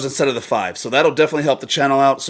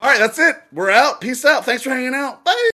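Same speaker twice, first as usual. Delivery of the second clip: high, loud cheerful goodbye; excited sign-off; 13 semitones higher, 4 dB louder than usual. Real, not acted. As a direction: wrap up the show brightly.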